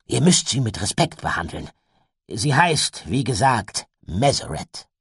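gehobene, nachdrückliche Vorstellung; gewichtiger Onkel. A narrator's reading. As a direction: betone jeden Satzanfang laut und gewichtig. Gideon stellt den Drachen vor. (eindringlich erklärender Onkel)

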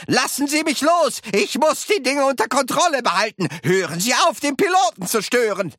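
high, frantic shouted protest; shouting. A narrator's reading. Pilot fighting off someone who grabs him, shouting. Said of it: "schrie Mister Wonka".

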